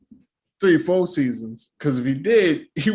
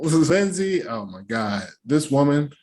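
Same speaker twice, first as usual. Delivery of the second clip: ordinary delivery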